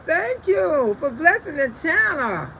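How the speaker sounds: ordinary talk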